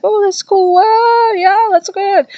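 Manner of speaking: high, airy mock stoner drawl; chill college kids. College kids chilling at the spirit board, high mock surfer voice. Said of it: "they're just all, like, chilling".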